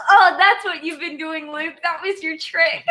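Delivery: high and loud, laughing through it; laughing at Luke's mumbling trick; laughing quote. Retold or imitated. Laughing teasing to Luke. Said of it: "And I was like".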